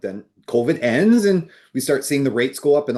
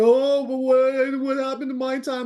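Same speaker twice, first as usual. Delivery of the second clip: a hammed-up whimper of regret; mock whining buyer; 7 semitones higher than usual. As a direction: whine it like a buyer who missed out. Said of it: "crying and screaming and like".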